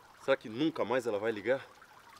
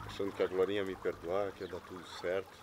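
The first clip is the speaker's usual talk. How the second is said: sad; 5 semitones lower, 7 dB quieter than usual. Acted. Dejected man confides in friend.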